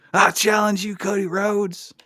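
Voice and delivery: high loud wrestling callout; loud challenge. Retold or imitated. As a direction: shout it like a ring challenge. Voicing a challenge.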